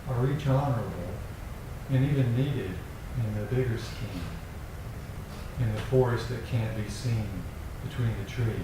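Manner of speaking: poem reading. Acted. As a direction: let each image land, unhurried. Reads a poem aloud.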